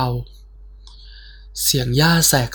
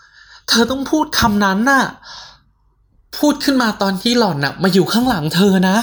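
urgent; 6 semitones higher than usual. Acted. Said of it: "สเตฟฟี่พูดขึ้นมา".

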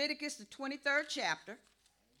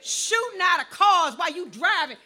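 raised, loud pulpit outcry; indignant preaching; 5 semitones higher, 9 dB louder than usual. Real, not acted. Preacher decrying shootings from moving cars, loud preaching. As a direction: exclaim it to the whole church.

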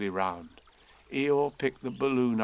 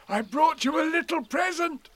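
high-pitched, eager bear voice; excited; 5 dB louder than usual. A narrator's reading. Pooh offering Eeyore a present, excited. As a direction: burst out with it, pitched way up. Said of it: "said Pooh excitedly".

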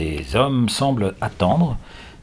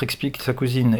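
plain reading voice

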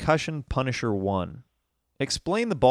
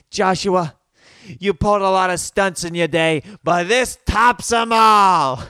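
high, loud scolding mother imitation; mad mom; 7 semitones higher, 8 dB louder than usual. Mom scolding her naked son, hammed-up fury. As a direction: build to a drawn-out, mock-outraged finish. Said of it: "she gets so mad".

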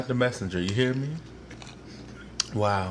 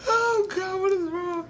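helpless squeal. Real, not acted. Overcome after being teased.

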